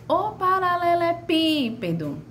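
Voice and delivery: high calling "ô paralelepípedo" with a long PÍ; calling, stretched PÍ. Shown as a demonstration. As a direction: call the word out, dragging "pí". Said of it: "se arrastou". (chamando "ô paralelepípedo", alto e arrastando o PÍ)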